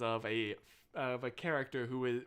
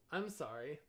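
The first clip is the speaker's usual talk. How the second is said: ordinary talk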